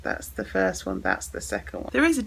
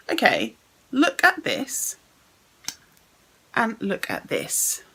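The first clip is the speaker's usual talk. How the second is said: usual speaking voice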